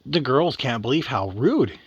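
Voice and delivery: ordinary delivery, nothing marked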